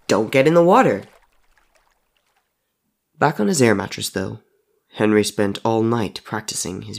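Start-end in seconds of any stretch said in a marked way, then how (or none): 0.1-1.0 louder, higher shouted retort. Acted. Henry shouting back at the dead boy as he runs. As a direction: call it back over the shoulder. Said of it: "he shouted over his shoulder".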